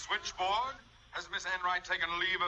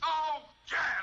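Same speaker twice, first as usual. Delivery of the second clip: much higher, loud angry cry; exasperated outburst; 11 semitones higher, 4 dB louder than usual. Acted. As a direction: blow up in indignation. Principal furious at insult.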